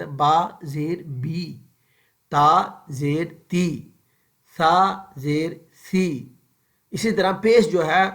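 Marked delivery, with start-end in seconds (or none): 0.1-6.3 "با زیر بی" slow with lips drawn down, short i; slow short-i drill; short i, lips lowered. Shown as a demonstration. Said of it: "ہونٹوں کو نیچے کی طرف جھکا کر".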